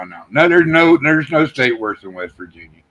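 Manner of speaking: ordinary talk, nothing marked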